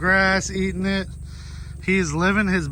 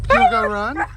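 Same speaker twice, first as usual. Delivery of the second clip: high, eager question to the dog; excited pet talk; 11 semitones higher than usual. Real, not acted. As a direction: pump the dog up to run.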